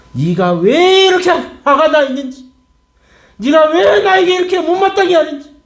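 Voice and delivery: building to a high, ringing plea; impassioned God. Acted. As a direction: stretch the 왜 and soar upward in full preaching voice. Preacher voices God addressing angry Jonah.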